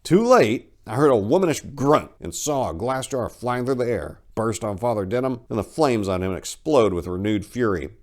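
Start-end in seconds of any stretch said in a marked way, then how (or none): none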